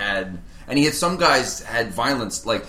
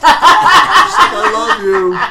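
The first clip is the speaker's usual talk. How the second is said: lovestruck fiancée, hammed; 11 semitones higher, 12 dB louder, much slower than usual. Fiancée's reply, acted.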